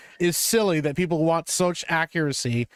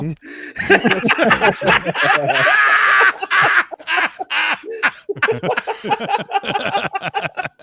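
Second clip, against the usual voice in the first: long, high, loud belly laugh; uproarious laughter; 13 semitones higher, 6 dB louder than usual. Real, not acted. Friend hearing the Gambit bit read out, uproarious laughter. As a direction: crack up helplessly.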